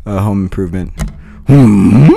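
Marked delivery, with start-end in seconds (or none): 1.5-2.1 loud questioning sitcom grunt; big grunt from the chest; Tim Allen. Acted. Comedian showing his only stage impression, Tim Allen grunt. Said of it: "which is only Tim Allen from, uh, Home Improvement".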